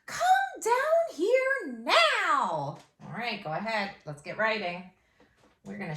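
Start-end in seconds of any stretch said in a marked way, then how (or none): 0.0-2.8 high, loud, slow parent yell. Retold or imitated. Teacher acting a parent calling a child downstairs, loud and high. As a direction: call the kid down like an angry parent. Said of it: "Have you ever heard that at home?".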